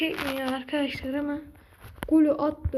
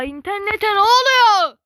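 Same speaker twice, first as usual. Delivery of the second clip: loud, higher desperate cry; exasperated shout; 5 semitones higher, 10 dB louder than usual. Real, not acted. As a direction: shout at the lagging internet. Internet failing, exasperated.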